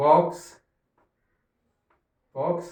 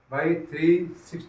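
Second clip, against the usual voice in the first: slow dictation; much slower than usual. Shown as a demonstration. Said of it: "कितना आएगा?".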